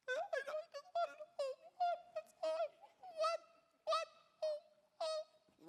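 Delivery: put-on very high whimpering child voice; crying little boy. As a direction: squeak it out like a sobbing kid.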